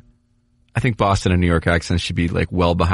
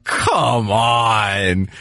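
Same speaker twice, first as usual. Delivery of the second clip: exasperated shout. Retold or imitated. Fans watching the Lakers get lucky, exasperated shout. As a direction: yell "Come on" at bad lottery luck. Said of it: "we're like".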